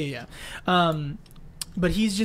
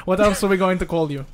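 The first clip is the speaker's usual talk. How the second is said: louder put-on Russian accent; 2 semitones higher, 5 dB louder than usual. [name] answers the travelers questioning his word, Russian accent. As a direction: ask it pointedly in a Russian accent. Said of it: "oh, uh, Russian".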